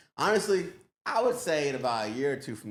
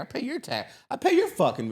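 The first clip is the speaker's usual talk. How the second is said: high, shrill-pitched entitled rant; mock entitled woman; 13 semitones higher, 5 dB louder than usual. Entitled woman ranting at a public servant, pitched high. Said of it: "they say that crazy shit".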